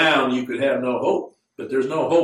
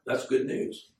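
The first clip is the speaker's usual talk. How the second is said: ordinary talk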